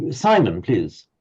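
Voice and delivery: usual speaking voice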